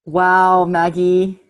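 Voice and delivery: normal talking voice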